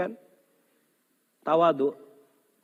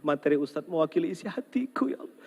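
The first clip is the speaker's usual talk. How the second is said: a secret sigh to heaven; whispered inner prayer; 3 semitones higher than usual. Retold or imitated. Husband whispering his heart's prayer while driving home. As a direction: whisper it, pleading to God.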